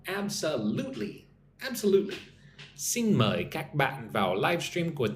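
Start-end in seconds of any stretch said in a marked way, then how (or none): 0.1-2.1 "Absolutely" with the LUTE punched; stress on third syllable. Shown as a demonstration. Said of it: "Put the emphasis on the third syllable".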